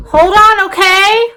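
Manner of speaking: loud, high-pitched call to someone off camera. Real, not acted. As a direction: raise your voice to hold off an interruption.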